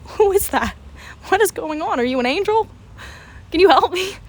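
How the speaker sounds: high, mock-tearful pleading; acted tearful bewilderment. Student in stall to unseen kid, hammed-up tearful pleading. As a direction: plead as if dazed and crying. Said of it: "I'm shaking and crying".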